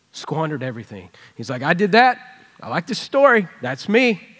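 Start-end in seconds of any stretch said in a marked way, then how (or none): 1.6-4.3 suddenly loud and high, thrilled to recognize himself; excited. Retold or imitated. Larry hearing the prodigal story, excited and loud. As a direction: blurt it out delighted, much louder.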